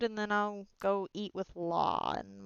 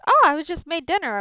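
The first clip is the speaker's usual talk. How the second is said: cheerful; 8 semitones higher, 11 dB louder than usual. Acted. Captain cheerfully greeting a crewmate.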